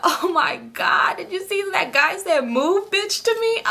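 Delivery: high, slow, giggly disbelief; retelling the rude stranger to her friend, laughing and high. Retold or imitated. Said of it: "I start laughing".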